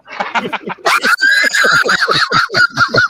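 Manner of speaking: loud high laughter; laughing. Real, not acted. Remembering the fake dog ransom calls, loud high laughter. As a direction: burst out laughing.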